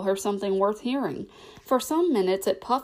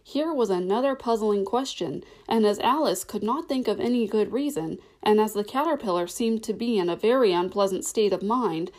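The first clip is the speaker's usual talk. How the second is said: plain reading voice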